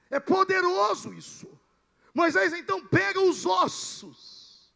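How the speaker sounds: loud, emphatic pulpit storytelling; full-force preaching. Real, not acted. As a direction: preach the miracle at full volume. (pregação vibrante, em voz muito alta)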